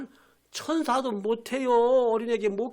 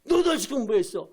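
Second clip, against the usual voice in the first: angry rebuke; 3 semitones higher, 3 dB louder than usual. Acted. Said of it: "막 화내고 분노하고".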